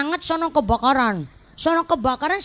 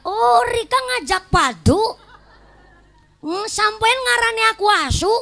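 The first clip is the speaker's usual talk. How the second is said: annoyed; 7 semitones higher than usual. Acted. Offended neighbor answers the question, annoyed and high-pitched. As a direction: take offense in a raised, stretched voice. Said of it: "Jengkel yang ditanya".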